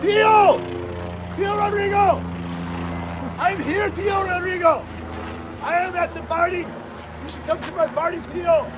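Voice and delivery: shouting up high and loud to someone far off; excited high shout in a Spanish accent; calling out. Pretend. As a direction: yell to get the passing helicopter's attention. Calling out to passing Tio.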